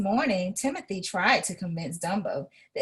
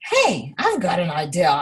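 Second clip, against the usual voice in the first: ringmaster voice; 2 semitones lower, 5 dB louder, faster than usual. A narrator's reading. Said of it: "he said".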